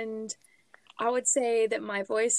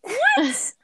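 surprised what; 11 semitones higher, 8 dB louder than usual. Real, not acted. Called out by name.

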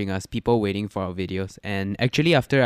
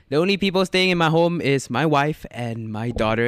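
normal talking voice

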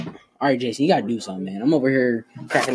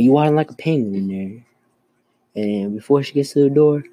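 ordinary talk

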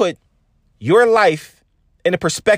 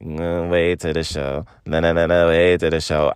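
dark sultry demeanor; 12 semitones lower than usual. Retold or imitated. Imitating the singer's dark teasing voice to his listeners. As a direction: purr it low like a singer holding back. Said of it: "She got this dark demeanor in her voice".

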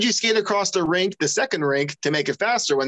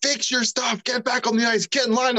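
retold yell; 6 semitones higher than usual. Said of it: "he yelled at me".

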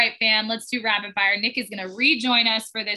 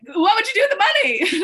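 much higher, louder quizzing voice; imitating Kay; 11 semitones higher, 6 dB louder than usual. Kay turning prom into a game, high and loud. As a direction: pitch it up like a teasing game host. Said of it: "Kay turned it into a game when she was like".

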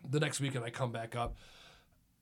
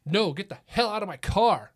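loud, higher mock yell; retold yelling; 4 semitones higher, 7 dB louder than usual. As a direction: imitate someone angrily ordering you out. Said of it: "It's just yelling at me".

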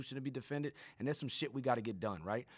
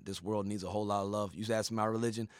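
ordinary talk, nothing marked

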